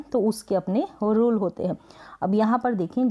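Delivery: ordinary delivery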